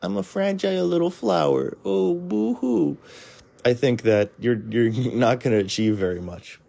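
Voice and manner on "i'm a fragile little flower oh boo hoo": mocking whiny voice. Mocking a fragile person.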